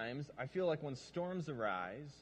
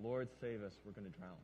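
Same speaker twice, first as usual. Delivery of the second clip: quiet version; 8 semitones lower, 8 dB quieter than usual. Shown as a demonstration. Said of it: "different ways to say the same line".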